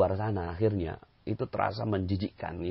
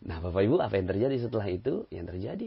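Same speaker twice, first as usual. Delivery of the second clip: normal talking voice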